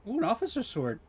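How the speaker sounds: usual speaking voice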